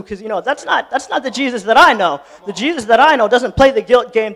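ordinary talk, nothing marked

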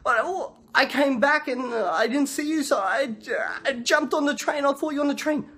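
high-pitched, faltering mock-tearful excuse; whimpering wife. Wife explaining why she boarded, mock whimper.

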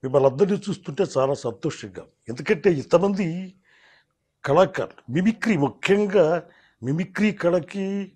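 slow, drawn-out film-star impression; Akkineni Nageswara Rao. Shown as a demonstration. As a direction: stretch the phrases like a veteran actor's speech. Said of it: "నా అక్కినే నాగేశ్వరరావు చెప్పాలంటే".

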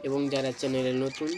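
ordinary delivery

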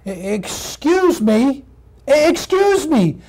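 high, chattering puppy voice; eager little puppy. Acted. Puppy hails wiener dog in a car, eager puppy voice. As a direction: brighten from tired to eager as a puppy.

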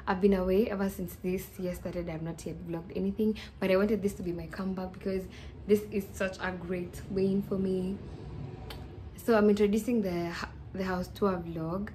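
usual speaking voice

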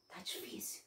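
soft whispered aside. Real, not acted. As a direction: narrator whispering to listeners about 2020. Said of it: "whispered aside".

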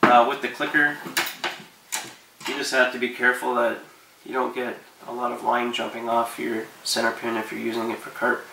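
usual speaking voice

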